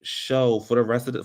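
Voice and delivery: usual speaking voice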